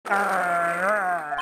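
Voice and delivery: deep drawn-out tiger growl. Acted. Acting out the tiger coming toward Nick, deep growl. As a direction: let the growl rumble low and long. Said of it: "[name] making tiger noises".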